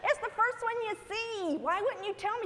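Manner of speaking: high-pitched comic indignation; indignant protest. Retold or imitated. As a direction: fire back, high and exasperated. Acted protest to the friend.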